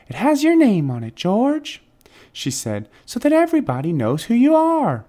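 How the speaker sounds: high, sweet nurse voice; chirpy and kind like a young nurse. Acted. Nurse telling George about his name bracelet, high and sweet.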